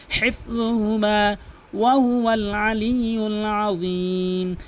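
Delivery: slow, melodic chanted recitation; Quran recitation. Shown as a demonstration. Teacher recites for students, slow melodic chant. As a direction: chant the verse slowly with long held vowels.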